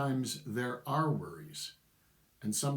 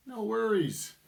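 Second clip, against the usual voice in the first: mock reply; 10 semitones higher, 4 dB louder than usual. Acting out bored replies.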